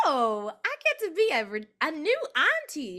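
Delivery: high, loud delighted gush; thrilled at the thought; giddy excitement. Retold or imitated. Telling her sister friend she'll be an auntie, giddy. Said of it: "And I was like".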